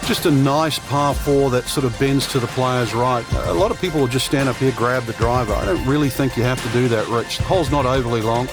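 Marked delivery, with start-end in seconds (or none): none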